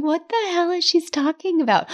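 baffled. Retold or imitated. Coach retelling her puzzled first reaction to a review, high and baffled. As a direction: act completely lost by what she wrote. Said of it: "sitting there going".

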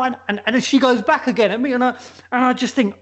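ordinary delivery

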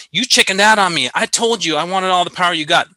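a hammed-up harsh retort; mock harsh. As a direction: toss it off like a blunt mechanic chewing out the pilot. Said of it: "Now, that was a little harsh".

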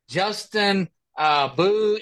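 normal talking voice

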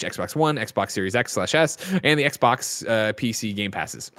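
ordinary delivery

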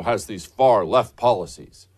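ordinary talk, nothing marked

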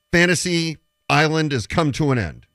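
usual speaking voice

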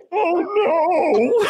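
high-pitched mock wail of dread; imitated crying wail. Rider retelling the boy as the coaster starts, imitated wail. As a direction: cry it out like a terrified kid. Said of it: "he's crying".

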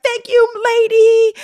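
high, drawn-out excited squeal; hammed-up high, slow exclamation; all-caps thanks. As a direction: shout it like an all-caps text. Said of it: "In all caps".

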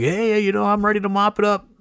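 mock-eager volunteer ready to mop; eager helper. Eager mop guy voiced.

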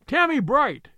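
high call across the beach; shout. Acted. Betteredge calling to a boy mending nets, shouting. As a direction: call out loud to a lad far off. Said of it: "he shouted at the top of his voice".

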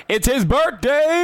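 high, excited birthday shout-out; excited announcement. Real, not acted. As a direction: hype up the birthday, slowly.

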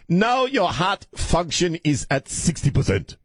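put-on very high, slow African-accented doctor; mock African accent. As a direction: imitate a cardiologist pronouncing results in an African accent. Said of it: "do a very racist accent".

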